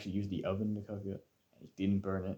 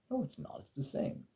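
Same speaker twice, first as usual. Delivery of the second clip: mock dad denial; 5 semitones higher, 5 dB quieter than usual. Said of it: "And he was like".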